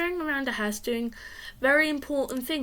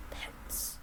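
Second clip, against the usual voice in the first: barely audible whisper; sneaky whisper; 17 dB quieter than usual. A narrator's reading. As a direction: breathe the word out, hoping it slips by. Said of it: "I whispered the word pets really softly".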